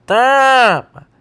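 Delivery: high, drawn-out nasal vowel being blocked; sustained pinched-nose demo. Shown as a demonstration. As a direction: hold the vowel long while pinching your nose. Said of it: "Ele tá prendendo".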